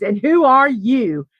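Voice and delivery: drawn-out, condescending mock question; dismissive trooper. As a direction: mimic a trooper demanding who this is. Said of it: "that's exactly how he said it".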